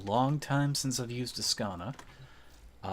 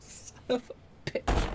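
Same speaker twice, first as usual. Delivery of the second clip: laughing curse; slower than usual. Real, not acted.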